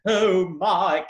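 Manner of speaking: mock-grave theatrical exclamation; overly serious. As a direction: declaim it like a costume drama. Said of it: "he was always so serious".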